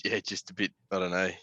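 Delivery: ordinary delivery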